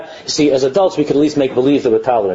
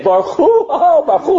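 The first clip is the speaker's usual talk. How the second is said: much higher, mocking "Baruch Hu"; mocking accent echo; 11 semitones higher than usual. Listeners mocking a visitor's prayer accent, high mimicry. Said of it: "And the kids all laugh".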